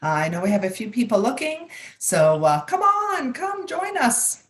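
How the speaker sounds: rally the live audience cheerfully; upbeat invitation. Real, not acted. Host invites viewers to comment.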